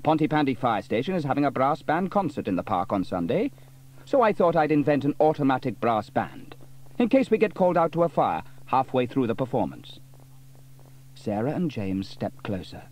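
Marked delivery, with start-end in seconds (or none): 0.0-10.0 a bit higher, louder eager explaining; keen Sam. A narrator's reading. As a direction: share the clever plan. Sam explains his plan.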